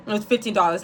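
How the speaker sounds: ordinary talk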